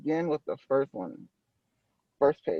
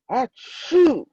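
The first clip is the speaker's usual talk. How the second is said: sneeze; 9 semitones higher, 6 dB louder than usual. A narrator's reading. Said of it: "SpongeBob is sneezing".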